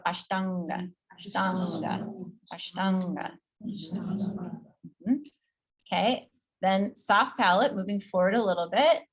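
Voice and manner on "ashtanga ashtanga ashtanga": ashtanga with a throaty ng; guttural nga. Shown as a demonstration. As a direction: pull the ng of ashtanga back. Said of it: "Think back of the throat".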